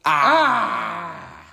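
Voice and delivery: loud, high comic "Ah!"; mock cry. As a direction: burst back in with a hammed-up cry. Mock shock after the outro.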